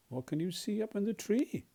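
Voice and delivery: plain reading voice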